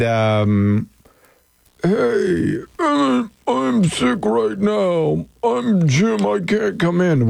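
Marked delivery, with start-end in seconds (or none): 1.8-7.3 high-pitched, dragging, badly faked illness; fake sick voice. Host demonstrating the contest call, badly faked sick voice. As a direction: put on an unconvincing sick voice, pitched up. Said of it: "Why can't I fake a good sick voice?".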